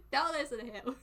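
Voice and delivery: loud plea bursting with laughter; laughing protest. Real, not acted. Streamer about to get in trouble, loud laughing protest. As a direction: shout it while cracking up.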